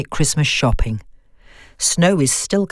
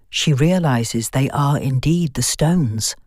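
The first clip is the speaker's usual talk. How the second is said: plain reading voice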